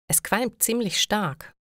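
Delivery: ordinary talk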